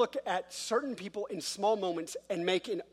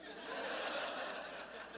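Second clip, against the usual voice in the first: quiet breathy laugh; 13 dB quieter than usual. Real, not acted. Laughing at own joke.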